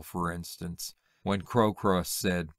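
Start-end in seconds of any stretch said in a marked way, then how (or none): none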